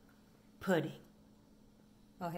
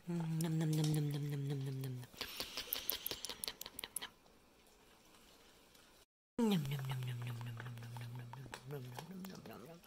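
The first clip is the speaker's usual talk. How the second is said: munching noises; 11 semitones lower, 10 dB quieter, much faster than usual. Pretend. Voicing dogs chewing.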